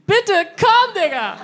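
high, loud pleading cry; mock desperate shout. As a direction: yell it in mock panic. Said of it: "zusammen geschrien". (gespielt verzweifelter Schrei, hoch und laut)

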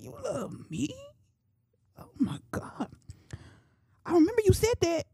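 tiny high voice, hushed with disbelief; hammed-up astonishment, squeaky and soft; acted shock. Speaker reenacting learning that Jesus loved her, shocked. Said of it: "I was shocked".

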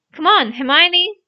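loud, high summons; urging call to someone above. A narrator's reading. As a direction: holler up to a friend to follow. Calling friend down through trapdoor.

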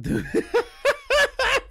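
high, loud laugh; sudden high-pitched cackle; burst of laughter. Real, not acted. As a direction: break up laughing mid-sentence. Streamer laughs mid-reading.

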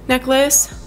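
ordinary talk, nothing marked

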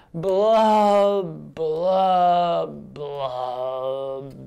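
long blubs stepping down in pitch; gloomy fish blubs. Acted. Teacher leads children in the blub part, descending blubs.

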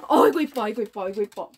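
high doting squeal of delight; delighted gasp and gush. Real, not acted. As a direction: gush over the puppy. Gushing over dog.

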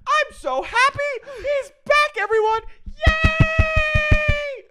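high, loud, slow squealing; high loud cartoon-like squeal; squealy impression. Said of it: "She goes".

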